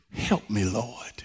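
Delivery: low, soft humble prayer; hushed plea. Retold or imitated. Mother worshiping Jesus and asking for help, hushed. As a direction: drop right down and plead quietly.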